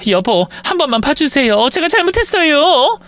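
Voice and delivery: pleading wife. Acted. Wife begs husband not to divorce.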